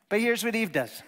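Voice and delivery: ordinary talk, nothing marked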